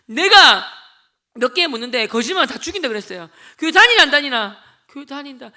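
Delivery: loud barked questions; menacing interrogation. Retold or imitated. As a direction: grill them against the wall. Interrogating classmates at wall. (벽에 세운 애들 윽박지르기)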